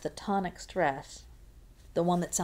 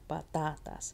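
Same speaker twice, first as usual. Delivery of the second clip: softer "patatas", stressed on the middle "ta"; stressed middle syllable; 3 semitones lower, 7 dB quieter than usual. Shown as a demonstration. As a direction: quietly lean on the second syllable of "patatas". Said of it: "Pa goes there".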